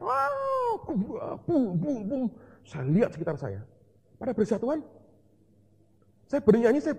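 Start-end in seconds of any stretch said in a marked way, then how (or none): 0.0-2.2 a very high cry then booming thuds of people falling; imitated shout and thuds. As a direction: mimic someone yelling as bodies drop. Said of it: "Ada orang teriak". (teriakan tiruan lalu bunyi bum bum)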